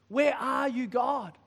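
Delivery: high, loud plea; anguished cry to God. Pretend. Preacher voicing a desperate believer, crying out loud. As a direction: call out to God in pain. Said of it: "Why isn't he shouting at me?".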